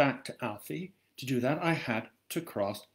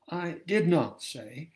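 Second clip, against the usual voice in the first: ordinary delivery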